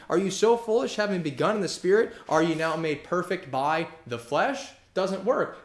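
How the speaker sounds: high, loud sermon delivery, driving each question home; fiery preaching. Acted. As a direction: thunder at the Galatians from the pulpit. Preaching at full force.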